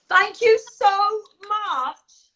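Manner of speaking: high, slow, gushing thank-you, voice breaking; overcome, thanking the voters from the heart; emotional thanks. Real, not acted. Emotional award thank-you, voice rising.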